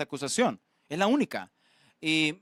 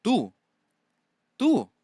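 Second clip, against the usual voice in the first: a scornful, rising "tú", higher on the second; mocking; 6 semitones higher, slower than usual. Preacher voices Pilate taunting Jesus, mocking. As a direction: sneer the word "tú" like Pilate. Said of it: "es como burla".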